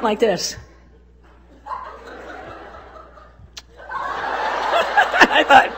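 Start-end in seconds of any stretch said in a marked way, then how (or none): 1.6-5.7 high squeak of laughter; laughing. Real, not acted. Telling the church a funny story, laughing high. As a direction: laugh out the words at the memory.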